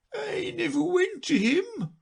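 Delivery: high, slow, crying sigh; a weepy creature lamenting; sighing and near tears. Acted. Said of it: "said the Mock Turtle with a sigh".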